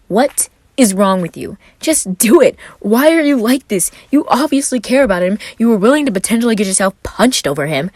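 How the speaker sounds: frustrated rant at himself; self-scolding. Acted. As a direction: chew yourself out in frustration.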